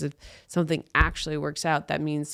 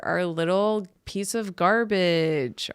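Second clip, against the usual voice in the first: ordinary delivery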